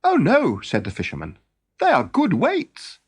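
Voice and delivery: high, keen fisherman voice; an eager fisherman pressing his offer. Acted. The fisherman offering fish to Thornogson, high and keen.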